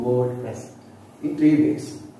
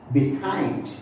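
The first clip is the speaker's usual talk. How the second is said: "behind" rising onto a strong HIND; stress on second syllable. Shown as a demonstration. Teacher listing prepositions, second-syllable stress. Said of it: "we give stress on the second syllable".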